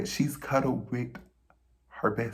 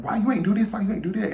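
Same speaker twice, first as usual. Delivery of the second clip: loud, high scolding questions; mock angry grandma fussing; mad grandma; 8 semitones higher, 6 dB louder, faster than usual. Grandma cussing her out for the mess, louder and higher. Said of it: "my grandma was just mad".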